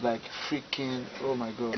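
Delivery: teeth-chattering cold complaint; shivering from cold. Real, not acted. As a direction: shiver through the words. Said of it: "you're complaining. It's cold".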